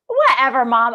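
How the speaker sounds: loud, mock-exasperated brush-off; scoffing. Daughter calling out mom's sudden change, loud scoff. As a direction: hit it hard in mock disbelief. Retold scoffing reply to mom.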